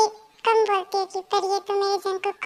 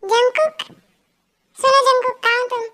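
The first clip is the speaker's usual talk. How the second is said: louder call, voice raised; 3 semitones higher, 5 dB louder than usual. Acted. Calling out a name across the house, raised. [name] calls out for [name].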